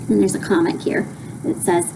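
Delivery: plain reading voice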